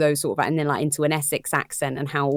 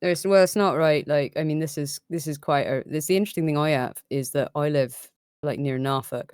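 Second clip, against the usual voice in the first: usual speaking voice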